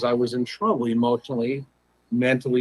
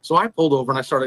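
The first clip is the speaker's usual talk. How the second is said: ordinary delivery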